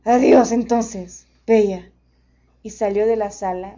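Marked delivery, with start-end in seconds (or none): 0.0-1.9 loud, slow gentle beast voice; a big beast saying a tender farewell; friendly monster voice. A narrator's reading.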